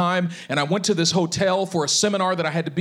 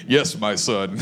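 deep, low divine reply for laughs; mock voice of God; 10 semitones lower, slower than usual. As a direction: play the Lord answering, pitched way down. Joking reply voiced as the Lord.

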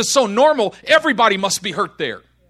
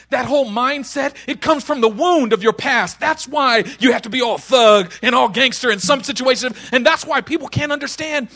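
normal talking voice

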